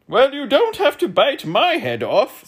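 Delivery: very high, loud protest; terrified scream. A narrator's reading. Terrified earl screaming at the others, very high. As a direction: shriek it as a frightened earl. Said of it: "screamed the terrified earl".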